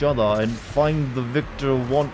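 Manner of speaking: slow, booming alien prophet; warrior prophet voice. Retold or imitated. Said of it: "he's going for that warrior prophet voice".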